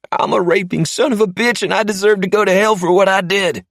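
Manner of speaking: high, loud frightened recital of the forced words; terrified captive repeating. Acted. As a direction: gabble the confession out in a scared high voice. Say it again.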